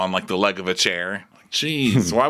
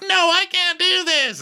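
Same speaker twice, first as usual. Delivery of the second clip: much higher, whiny mock refusal; voicing the dog; 3 dB louder than usual. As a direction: squeak it like a panicked pet. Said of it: "Peaches is just like".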